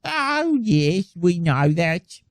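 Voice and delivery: Brummie parody, starting high then drawn out; mock Birmingham accent. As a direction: stretch it out in a put-on Birmingham voice. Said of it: "I always do a Birmingham accent".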